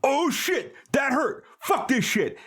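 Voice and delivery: wounded cartoon shark; hurt shark. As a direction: Jaws yelping after being stabbed. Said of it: "And he goes".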